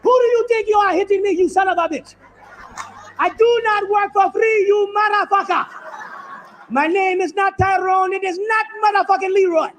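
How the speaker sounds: hammed-up furious slave; proud slave shouting back at his master, mock furious; defiant yell. Acted slave defying master.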